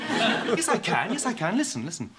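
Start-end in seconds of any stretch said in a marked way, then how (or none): none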